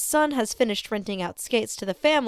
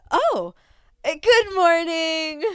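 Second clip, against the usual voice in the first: cheerful greeting; 9 semitones higher, 6 dB louder, slower than usual. Acted. Customer service greeting.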